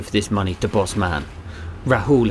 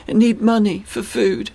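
put-on child voice; 11 semitones higher than usual. A narrator's reading. Begging boy voiced in story.

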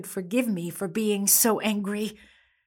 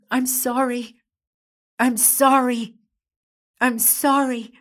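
repeated apology; 4 semitones higher, 6 dB louder than usual. Acted. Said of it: "I just couldn't stop from saying".

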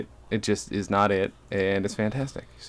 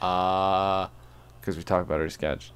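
ordinary delivery

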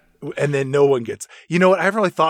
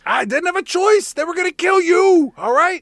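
higher, louder defensive plea; mock frantic pleading; 5 dB louder than usual. Will Smith defending the shooting, higher frantic plea. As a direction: justify yourself in a panic.

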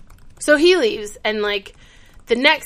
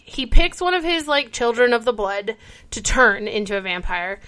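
ordinary talk, nothing marked

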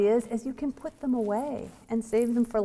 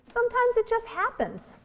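put-on much higher, casual shrug of a little girl; matter-of-fact child; 14 semitones higher than usual. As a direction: toss it off like a small child who doesn't care. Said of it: "shrugged and said very matter-of-factly".

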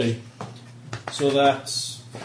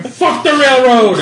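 slow, loud and much higher yell; furious battle cry; 8 semitones higher, 11 dB louder, slower than usual. Retold or imitated. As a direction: bellow it as you charge in. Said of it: "I'm going".

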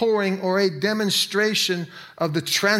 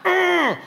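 frustrated groan; 13 semitones higher, 6 dB louder than usual. Retold or imitated. Acting out his own frustration.

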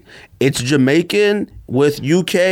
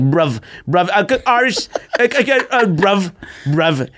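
hammed-up London Jamaican slang, pitched up; mock British-Jamaican accent; 7 semitones higher, slower than usual. Host imitating Top Boy characters, mock British-Jamaican accent. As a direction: imitate thick British-Jamaican speech, half laughing. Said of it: "the Jamaican and the English accents".

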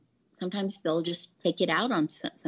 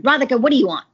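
loud, raised-pitch imitation of a cranky doctor; mock irritated demand, pitched up and louder; retold irritated doctor; 6 semitones higher, 8 dB louder, much faster than usual. Doctor to young sales rep on his bad day, irritated. Angry doctor snaps at her.

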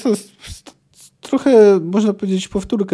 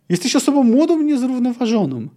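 higher, raised outburst; 8 semitones higher, 3 dB louder than usual. Acted. Old Wysogota erupts at Ciri, higher voiced outburst. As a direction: burst out at the girl, higher. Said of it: "wybuchnął".

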